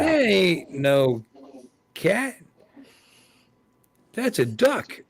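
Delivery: high, very slow, drawn-out know-it-all voice; mocking street guy. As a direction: pitch it way up and stretch each word, correcting a fool. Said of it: "the other guy looks at you and goes".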